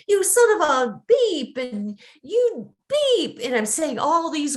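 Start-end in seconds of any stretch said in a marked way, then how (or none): 0.1-1.6 higher, louder road-rage curse; angry driver. Retold or imitated. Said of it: "And I'm angry. I'm like". 2.2-3.4 much higher mock curse at the driver; angry driver. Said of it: "And I'm angry".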